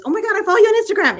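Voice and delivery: fast, high gushing squeal of recognition; excited fan gush. Retold or imitated. Fan in the hallway recognizing the host, fast excited gush. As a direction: blurt it out like a thrilled fan. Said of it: "she says".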